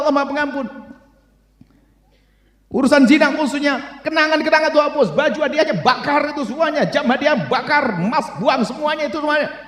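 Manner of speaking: high, driving sermon exhortation; impassioned preaching. Real, not acted. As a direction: exhort the congregation at a high pitch. Preaches at full force.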